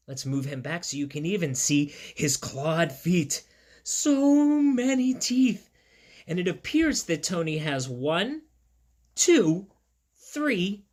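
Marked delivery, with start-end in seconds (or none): 3.8-5.7 amazed. Acted. Marvelling at teeth.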